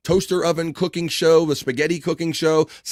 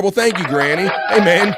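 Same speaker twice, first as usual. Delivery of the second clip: put-on higher, louder nasal-free goofy man-child voice; Pee Wee Herman voice; 6 semitones higher, 5 dB louder than usual. Host thanking viewer Granny in a Pee Wee Herman voice. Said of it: "I got the Pee Wee Herman voice".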